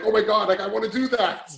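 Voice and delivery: high, loud imitated enthusiasm; excited. Member admiring another's plate, excited. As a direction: gush as if wowed by it. Acting envy at others' plates.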